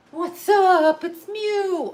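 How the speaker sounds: very high, drawn-out squeal to a pet; high silly pet voice; pet-talk voice. Real, not acted. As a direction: squeal it like greeting a beloved pet. Talking to pet she picks up.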